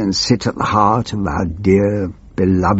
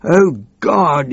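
higher, fast panicked wail; hammed-up wail of a panicking minister; 8 semitones higher than usual. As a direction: cry it out like a snivelling wretch. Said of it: "he wailed".

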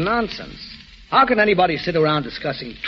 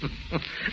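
quiet airless scoff; scoffing laugh; 14 dB quieter than usual. Acted. Scoffs at Sloan.

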